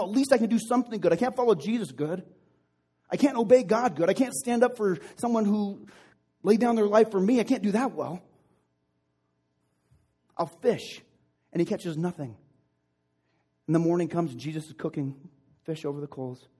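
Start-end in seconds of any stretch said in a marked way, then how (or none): 0.0-11.0 loud, high outburst of self-reproach; agitated self-reproach. Acted. Peter giving up on following Jesus, agitated self-reproach. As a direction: berate yourself in a raised, urgent voice.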